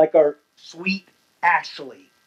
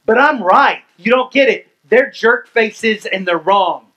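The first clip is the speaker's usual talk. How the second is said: louder, indignant listener whining back; mock self-righteous; 5 semitones higher, 5 dB louder than usual. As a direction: play the defensive churchgoer insisting they're right. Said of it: "this one's yours".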